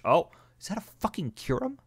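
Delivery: high astonished question; stunned. Real, not acted. Player gasping at a legendary landing. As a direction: shoot up in shock.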